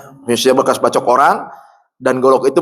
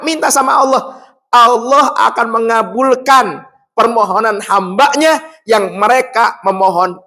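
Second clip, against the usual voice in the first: preaching at full force; 11 semitones higher than usual. Acted. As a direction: lift it up and proclaim to the crowd. (khotbah lantang penuh semangat)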